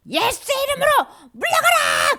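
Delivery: very high, loud rebuking yell; commanding shout. Shown as a demonstration. Teacher showing children how to banish Satan, commanding shout. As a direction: shout it with all your might.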